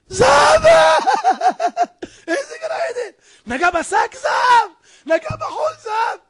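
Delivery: very high, loud shrieks of delight; ecstatic shrieking. Acted. Poor man finds his touch makes gold, ecstatic high shrieks. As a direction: shriek in hammed-up amazement, breaking into laughter.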